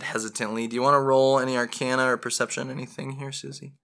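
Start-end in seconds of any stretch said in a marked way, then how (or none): none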